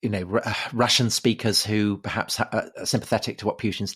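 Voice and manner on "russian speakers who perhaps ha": ordinary talk, nothing marked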